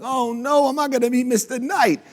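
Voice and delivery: high, whining mock despair; mock whiny doubter. Mocking the doubters who gave up, high whiny voice. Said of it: "everyone's like".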